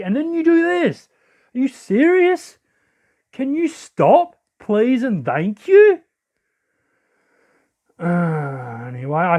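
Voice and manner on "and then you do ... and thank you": high, indignant plea, slowing at the end; real exasperation. Real, not acted. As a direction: beg them to stop, drawing out each word. Exasperated at opponent's move.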